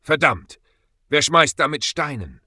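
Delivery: loud, high cry of pain; pained shout. A narrator's reading. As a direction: yell it out, stung and angry. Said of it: "Er schrie auf".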